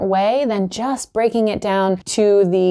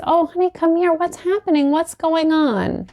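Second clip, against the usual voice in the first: put-on soothing a crying child, pitched up; tender comforting; 7 semitones higher than usual. Comforting a hurt child, tender and high. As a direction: coo gently like a caring parent. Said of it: "what we say is".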